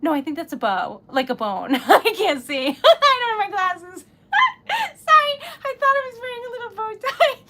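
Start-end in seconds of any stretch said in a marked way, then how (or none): 2.8-7.5 high, loud giggling speech; laughing. Real, not acted. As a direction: giggle through the words. Laughing at own mistake.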